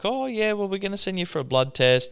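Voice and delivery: high, sing-song imitation of the doctor; mimicking doctor. Retelling the doctor's words to a mate, in a high mocking voice. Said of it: "they're like".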